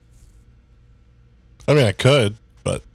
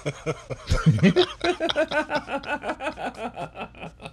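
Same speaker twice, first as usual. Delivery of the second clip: long, high, soft laughing fit; high giggle; 7 dB quieter than usual. Real, not acted. Co-host cracking up at the host, high giggling. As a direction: giggle helplessly, high and quiet.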